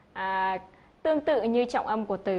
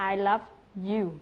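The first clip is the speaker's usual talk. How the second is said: slow "I love you" leaning on "you"; "you" stressed, "I love" light. Shown as a demonstration. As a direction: make "you" the focus. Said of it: "anh ta sẽ nhấn vào cái chữ "you".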